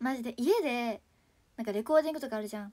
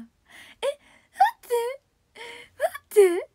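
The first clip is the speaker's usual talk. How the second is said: high loud squeal of joy; overjoyed squeal; 10 semitones higher, 10 dB louder, much slower than usual. Real, not acted. Moved by trill success.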